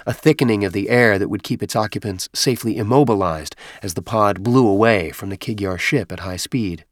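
plain reading voice

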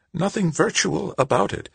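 put-on a gentle elderly lady, unhurried; old woman voice.

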